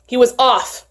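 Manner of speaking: plain reading voice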